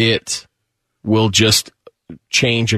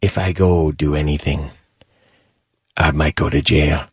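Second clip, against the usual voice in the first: lower dad voice; 6 semitones lower than usual. Retold or imitated. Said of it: "my dad was like".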